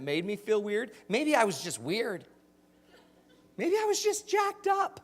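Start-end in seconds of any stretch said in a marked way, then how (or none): 3.6-5.0 much higher, silly squeak on jacked up; goofy high-pitched punchline; squeaky goofy voice. Shown as a demonstration. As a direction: pop the punchline up into a cartoon squeak. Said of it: "That's my favorite line".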